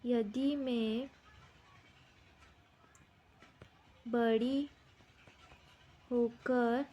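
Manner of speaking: word by word with long pauses; slow dictation. Shown as a demonstration. Teacher giving children a question to write, slow dictation. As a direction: dictate slowly, leaving time to write after each word.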